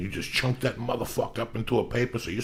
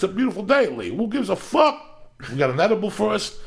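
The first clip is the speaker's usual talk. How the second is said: higher, louder hyped-up rant; excited; 10 semitones higher, 4 dB louder than usual. Real, not acted. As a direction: pump it up like a preacher. Hyped morning rant to Lee.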